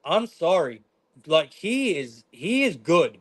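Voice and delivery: ordinary talk, nothing marked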